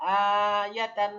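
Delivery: আয়াতান stretched slowly, each syllable held, loud and clear; teacher drawing out আয়াতান for students to copy; slow model word. Shown as a demonstration. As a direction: spell আয়াতান out slowly for the class. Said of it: "কি উচ্চারণ?".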